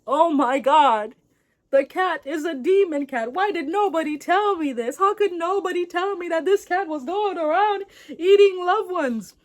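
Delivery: high, loud, dramatic father's lament; mock distraught outcry. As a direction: wail in hammed-up disbelief. Acted father shocked at losing sons.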